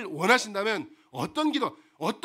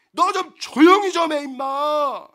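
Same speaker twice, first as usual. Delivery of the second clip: scolding crowd; 4 semitones higher, 7 dB louder, slower than usual. Acted. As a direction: scold the beggar to shut up. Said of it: "막 사람들이 야단을 쳐요".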